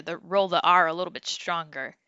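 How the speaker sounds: usual speaking voice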